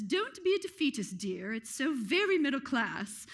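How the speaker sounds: a lightly put-on posh British dowager; hint of an English aristocrat's accent; bad British accent. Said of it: "Sorry for the bad British accent".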